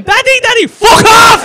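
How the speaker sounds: put-on high, loud shouting toddler; child's voice. Dad imitating his toddler shouting at him. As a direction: shriek like a cheeky kid. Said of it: "And he's going".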